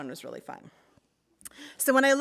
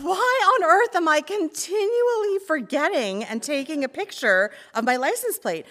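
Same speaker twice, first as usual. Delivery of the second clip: baffled self-question; 8 semitones higher, 6 dB louder than usual. Retold or imitated. Own baffled thought, retold.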